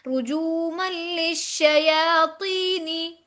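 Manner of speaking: drawn-out रुजूमल लिश्शयातीनी; tajweed joining. Shown as a demonstration. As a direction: snap into the joined ल and श, stretch the long vowels. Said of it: "लाम से मिलाते हुए झटका".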